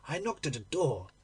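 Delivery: usual speaking voice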